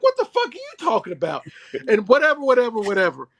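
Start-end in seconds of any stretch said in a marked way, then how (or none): 0.0-1.4 high-pitched, incredulous retort; mock outrage. Dad retelling his reply to a boy's remark, mock-outraged.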